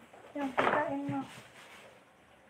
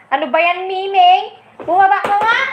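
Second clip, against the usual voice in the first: scolding; 3 semitones higher, 5 dB louder, faster than usual. Real, not acted. Scolding the pet Miming.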